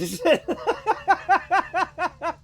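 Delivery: high, loud burst of laughter. Real, not acted. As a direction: crack up in a long, high cackle. Laughs at own remark.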